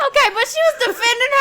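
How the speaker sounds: high, loud, fast laughing objection; laughing protest. Real, not acted.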